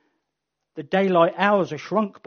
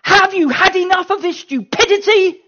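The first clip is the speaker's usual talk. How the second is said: high, loud exasperated demand; an angel fed up, shouting; loud, high exasperated rebuke; 13 semitones higher, 11 dB louder than usual. Acted. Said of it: "God sending an angel to say".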